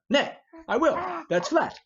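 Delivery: loud, high and headstrong; stubborn refusal. Acted. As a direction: slam the door on the argument. Hotspur refuses Worcester.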